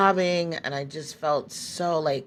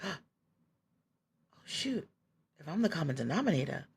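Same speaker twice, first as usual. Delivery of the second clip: hushed and much softer, speeding up at the end; dawning realization; 10 dB quieter than usual. Retold or imitated. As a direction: murmur it to yourself as it sinks in. Retells her dawning realization.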